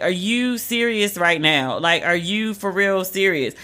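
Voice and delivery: slow, drawn-out deadpan question; slow disbelief. Retold or imitated. Asking the pool guard, slow deadpan disbelief. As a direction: stretch each word, unimpressed.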